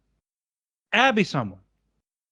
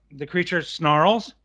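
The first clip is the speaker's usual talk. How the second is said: ordinary talk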